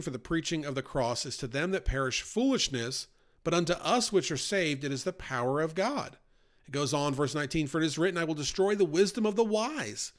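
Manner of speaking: normal talking voice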